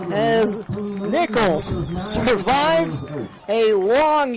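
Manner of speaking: excited call. Real, not acted. Radio announcer calling the game-ending catch, excited and loud. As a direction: sell the game-winning catch.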